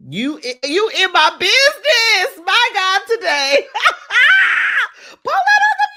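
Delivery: real tears breaking into laughter; laughing through tears. Real, not acted. Touched by a viewer's comment, laughing and crying loudly.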